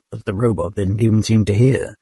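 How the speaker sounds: ordinary delivery, nothing marked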